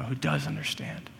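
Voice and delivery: dropping to a near whisper; hushed. Real, not acted. Preacher confides God understands, near whisper. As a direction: let it fall away into a breath. Quiet trust after sovereignty list.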